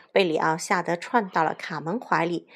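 usual speaking voice

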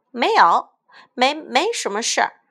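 high, loud, tripping over the words; flustered stammer. Acted. Carmen caught by her brother, stammering a denial. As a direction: stutter out a denial, caught off guard. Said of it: "卡门结结巴巴地解释".

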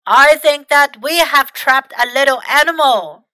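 loud, comic gruffness; funny gruff voice. A narrator's reading. Tim tricking the trapped mouse, funny gruff voice. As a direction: put on a silly, rough voice. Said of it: "said in a funny, gruff voice".

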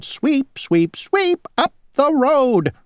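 high singsong sweeping fly; buzzy housefly. Acted. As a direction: sing out each sweep, high.

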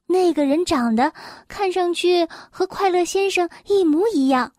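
plain reading voice

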